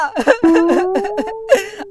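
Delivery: hammed-up half laugh, half cry; laughing sob. Student blubbering his excuse to the teacher, laughing sob.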